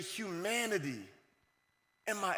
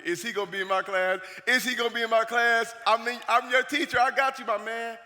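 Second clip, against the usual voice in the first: louder, higher, building eagerness; pumped-up, emphatic welcome; excited teacher; 4 semitones higher, 5 dB louder than usual. Retold or imitated. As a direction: imitate a teacher thrilled to get you. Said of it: "Bro, you're excited".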